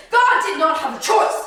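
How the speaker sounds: loud, high proclamation; emphatic declaration. Real, not acted. Preacher declaring God had no choice, loud and emphatic. Declaration, then repeated softly.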